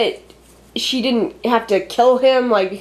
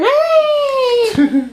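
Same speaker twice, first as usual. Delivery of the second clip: jump scare shout; 14 semitones higher than usual. Acted. Mimicking the film's jump scare with a sudden high yelp. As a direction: leap out with a startling high cry.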